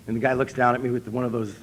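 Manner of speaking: ordinary talk, nothing marked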